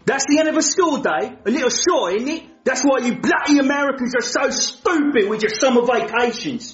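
put-on cheeky cockney-style British accent; mock London accent. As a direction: lay the British accent on thick. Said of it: "I don't like his accent".

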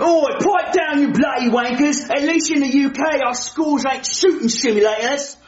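huffy put-on British accent; offended British accent; British accent, indignant. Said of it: "I don't like his accent".

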